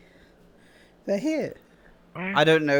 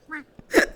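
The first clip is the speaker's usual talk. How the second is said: giggle while tickled; 11 semitones higher than usual. Acted. Tickled laughter.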